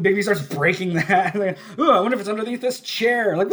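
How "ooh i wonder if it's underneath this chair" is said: high, loud mock wonder; feigned ignorance. Detective pretending to search under furniture, mock-curious. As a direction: play dumb in an exaggerated high voice.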